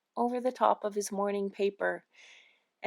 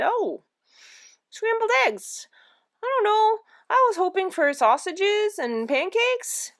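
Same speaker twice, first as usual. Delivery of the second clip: higher, hemming breakfast complaint; disappointed whine; 12 semitones higher, 4 dB louder than usual. A narrator's reading. Husband hoping for pancakes at breakfast, mildly disappointed. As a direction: voice a let-down husband, pitched up.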